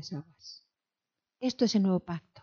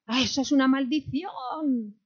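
mock fright; 6 semitones higher than usual. Mimics fearful believer.

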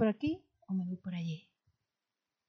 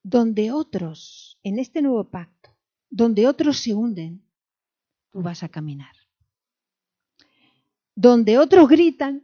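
fiery preaching; 3 semitones higher, 9 dB louder than usual. Acted. Preacher rallying the women of the church, loud and rising. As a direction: build to a loud, high preaching cry.

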